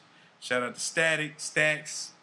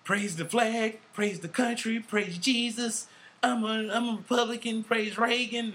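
high, preachy mock patriot voice; hammed-up flag-waving Republican, pitched up; mocking conservative Republican; 8 semitones higher than usual. Mocking a flag-waving Republican to his podcast cohost, hammed-up and high. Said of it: "not one of them motherfucking, like".

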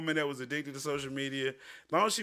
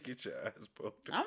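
quoting her; 7 semitones lower, 12 dB quieter than usual. Retold or imitated. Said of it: "she said".

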